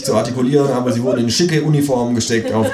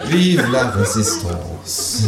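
very slow, stretched French slogan; grand, slow sign-off; drawn-out battle cry; much slower than usual. Acted. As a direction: draw out each syllable like a rallying cry. Closing battle cry of the story.

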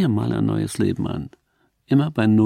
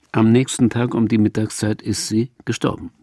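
plain reading voice

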